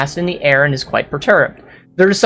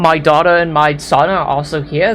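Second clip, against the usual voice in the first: ordinary talk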